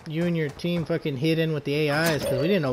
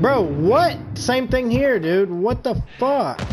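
panicking gamer; 6 semitones higher, slower than usual. Real, not acted. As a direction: yelp in disbelief at dying. Sudden one-tap death in game.

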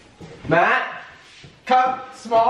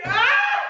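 laughing yelp. Real, not acted. Laughing yelp while measuring.

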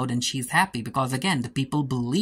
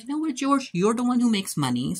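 higher put-on voice, a touch lofty; haughty Bertha; 10 semitones higher than usual. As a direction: lift the pitch, talking down to him. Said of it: "And Bertha's like".

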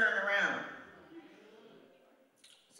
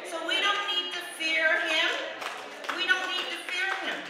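emphatic preaching; 6 semitones higher, 5 dB louder than usual. Real, not acted. Preacher declares no fear.

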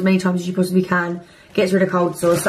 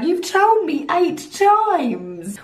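high, slow exasperated whine; exasperated viewer; 10 semitones higher, much slower than usual. Retold or imitated. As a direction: groan it out, fed up. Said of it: "And you're like".

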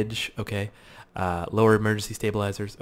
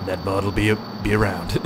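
ordinary talk, nothing marked

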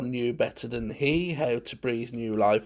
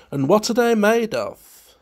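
higher, louder inquiring voice; puzzled question; 8 semitones higher, 6 dB louder than usual. A narrator's reading. Guest asks Munt what they are made of, puzzled question. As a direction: put the question with lifted, lively pitch.

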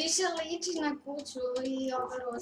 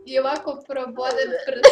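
laughing; 7 dB louder than usual. Real, not acted. Kids laughing at a build.

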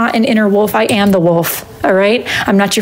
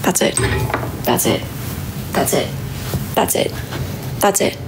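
fading out; 3 semitones lower, 8 dB quieter than usual. Real, not acted. Repeating, fading away.

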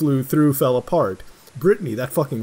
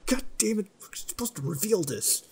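ordinary talk, nothing marked

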